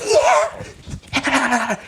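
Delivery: hammed-up background extra; party extra cheer. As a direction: whoop too eagerly at the camera. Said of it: "they're like".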